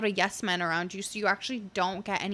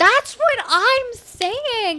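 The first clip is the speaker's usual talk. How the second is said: excited agreement; 12 semitones higher, 12 dB louder, slower than usual. Real, not acted. Emphatic agreement.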